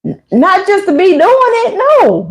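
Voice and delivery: high startled protest; mock flustered no; flustered denial. Retelling her reply to a prying sister, high flustered denial. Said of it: "I'm like".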